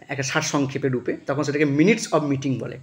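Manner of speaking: ordinary talk, nothing marked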